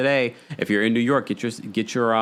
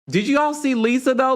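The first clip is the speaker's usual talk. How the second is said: ordinary talk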